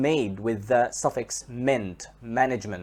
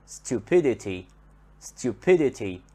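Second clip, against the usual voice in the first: "stupidity" with PID stressed, falling after; stress before the suffix. Shown as a demonstration. Teacher adds the suffix, PID in "stupidity" stressed. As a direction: lean on PID in "stupidity": stu-PID-ity. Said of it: "stress here comes on the syllable that is before".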